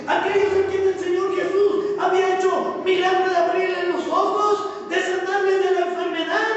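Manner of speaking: fervent preaching. Acted. Pastor telling the congregation about the ungrateful crowd, rising fervent preaching. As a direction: build the indignation toward the crowd.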